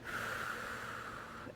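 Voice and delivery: long sigh through parted lips; audible sigh out. Shown as a demonstration. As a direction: let the breath go with a sigh. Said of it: "part the lips, side out".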